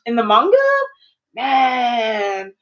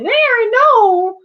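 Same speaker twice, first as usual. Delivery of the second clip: emphatic refusal; 11 semitones higher, 4 dB louder than usual. Retold or imitated. Ending a rant at Annie.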